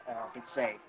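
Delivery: ordinary delivery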